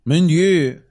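high, exaggerated mock French accent; french chef. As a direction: camp it up like a cartoon French chef. Mock French after "maître" joke.

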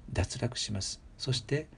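ordinary talk

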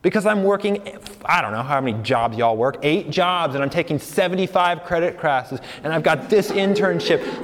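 ordinary talk

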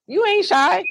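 very high-pitched mock protest; squeaky, incredulous quote; teasing insistence. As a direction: imitate a pastor calling out false modesty. Pastor teasingly insists she's not shy.

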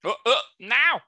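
ordinary talk, nothing marked

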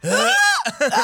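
loud mock gagging; exaggerated grunt of disgust; imitated retching noise. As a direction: act out gagging at the food. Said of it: "macht wirklich".

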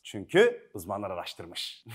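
normal talking voice

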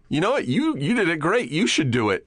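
high, dismissive mock Todd; quoting Todd. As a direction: Todd brushing off the director. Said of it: "Todd Glass just goes".

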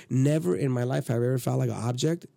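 ordinary talk, nothing marked

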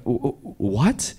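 soft, halting mock stammer; stunned stammer. Storyteller caught by his friend, stunned stammer. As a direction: act caught out, stuttering into a question.

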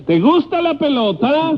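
high mock dummy voice; high-pitched imitation of a stage voice; ventriloquist voice. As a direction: mimic his holler like a ventriloquist. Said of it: "usted fue ventríloco alguna vez?".

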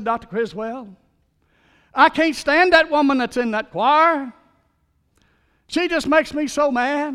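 loud, high rant at the senior pastor; angry outburst. Retold or imitated. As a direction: blow up at the pastor in frustration. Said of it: "he was so mad about that person".

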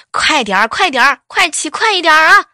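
loud, rising yells of "快点儿"; shouting encouragement. Retold or imitated. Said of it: "还一直喊". (哥哥在后面大声催喊)

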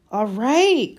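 high, loud exclamation; crying out. A narrator's reading. Boy stopping the elf hurting himself, crying out. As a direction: cry out to halt him. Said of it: "cried Harry".

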